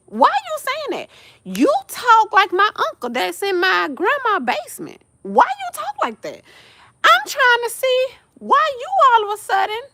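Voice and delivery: high pitched baffled asking; incredulous question. Retold or imitated. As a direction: ask him in disbelief. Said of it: "mock asks friend".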